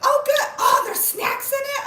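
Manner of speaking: high, loud delighted surprise; excited squeal. Real, not acted. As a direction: squeal as if a treat just appeared. Discovering snacks in the box.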